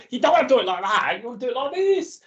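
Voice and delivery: lampoon a smug sketch-show character who poo-poos every idea; know-it-all TV character. Retold or imitated. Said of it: "I give him that accent".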